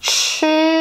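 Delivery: "chi" with rounded lips; lips pursed. Shown as a demonstration. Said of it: "You round your lips".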